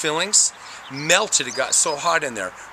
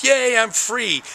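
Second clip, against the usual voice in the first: mock cheer; 9 semitones higher, 3 dB louder, slower than usual. Cord pops out.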